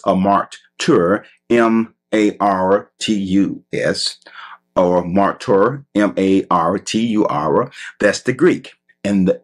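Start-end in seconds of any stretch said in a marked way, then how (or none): 1.5-7.7 deliberate, teacherly spelling; spelled out. Shown as a demonstration. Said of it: "Let me spell that for you".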